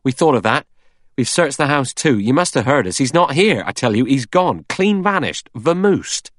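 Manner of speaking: insistent Blore. A narrator's reading. Blore insisting to Vera that Armstrong vanished, rising loud. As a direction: insist he's gone, voice rising. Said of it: "Blore said".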